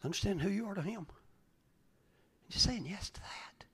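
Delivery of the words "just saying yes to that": whispered, intimate; hushed invitation. Shown as a demonstration. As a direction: breathe it out softly. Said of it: "repeated as whisper".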